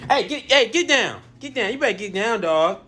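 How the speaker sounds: high loud yell at the dog; shouting. Real, not acted. Dog eating the food.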